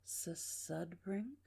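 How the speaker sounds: soft, drawn-out repeat of a name; very deliberate. Shown as a demonstration. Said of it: "that was a very deliberate".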